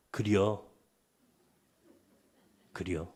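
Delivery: low, soft Chungcheong drawl; Chungcheong dialect. Shown as a demonstration. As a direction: say it like a Chungcheong local, quietly. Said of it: "이게 충청도 사투리거든요". (충청도 사투리로 낮고 나직하게)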